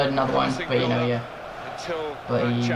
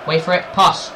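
usual speaking voice

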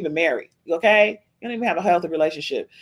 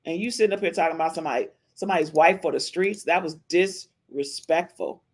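ordinary talk

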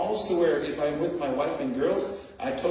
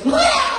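roar; 11 dB louder than usual. Retold or imitated. Demonized man reacts on sidewalk.

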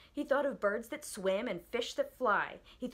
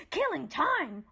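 higher, louder furious roar; roaring dog; 6 semitones higher, 6 dB louder, slower than usual. Acted. Watchdog scolding Milo, furious roar. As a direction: roar it in outrage. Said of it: "roared the dog".

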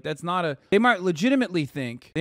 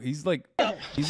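normal talking voice

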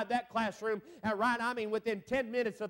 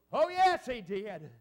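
emphatic affirmation; 3 dB louder, slower than usual. Real, not acted. Preacher answering his own question to the congregation, emphatic affirmation. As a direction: punch it out as a ringing yes.

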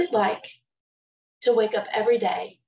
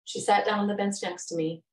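ordinary talk

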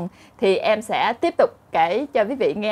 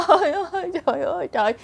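mock wailing; 7 semitones higher, faster than usual. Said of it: "ảnh khóc".

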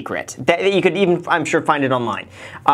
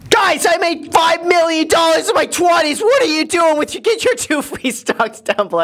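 mock flex to his followers; shirtless guru impression; 11 semitones higher than usual. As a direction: boast like a shirtless finance bro. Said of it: "takes his shirt off and does this".

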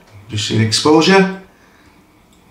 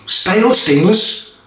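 "Stale stainless?" asked much higher and slow, puzzled; "Stale stainless?" as a baffled question; puzzled at own slip; 8 semitones higher, slower than usual. Real, not acted. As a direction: catch your own mistake. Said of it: "Stainless steel version".

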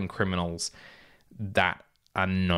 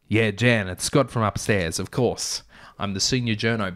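ordinary delivery, nothing marked

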